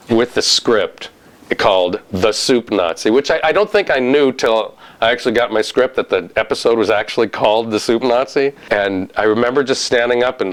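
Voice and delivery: ordinary talk, nothing marked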